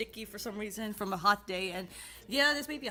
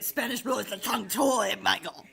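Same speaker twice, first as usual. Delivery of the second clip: louder, huffy comeback; mock-indignant retort; 6 dB louder than usual. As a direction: snap back at your friend in mock annoyance. Retorting to a friend, mock-annoyed.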